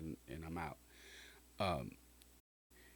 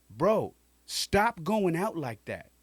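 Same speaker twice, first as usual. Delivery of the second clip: loud, slow, higher command; stern yell; 7 semitones higher, 7 dB louder, slower than usual. Retold or imitated. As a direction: bark it slowly so it lands. Cousin after slapping him.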